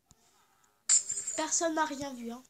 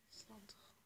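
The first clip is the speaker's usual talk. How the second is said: whisper; 3 semitones lower, 21 dB quieter than usual. Real, not acted. Whispered correction.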